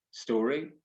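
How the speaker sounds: usual speaking voice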